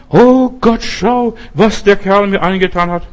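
shouted lament, pitched high and loud; crying out to God. Acted. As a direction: wail it up to God like spilled blood crying out. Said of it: "schrie zum Herrn".

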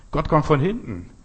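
ordinary talk

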